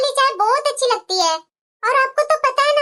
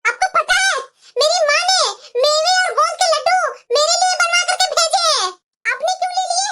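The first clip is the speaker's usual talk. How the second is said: raised, higher-pitched indignant voice; angry scolding; 4 semitones higher than usual. Acted. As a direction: confront her over something taken from you. Daughter-in-law scolds over taken laddus. (गुस्से में ऊँची आवाज़ में डाँटते हुए)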